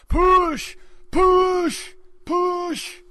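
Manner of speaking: high, loud hammed-up push cries; mock frantic Joseph. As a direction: yell like a panicked husband at the birth. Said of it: "Joseph saying".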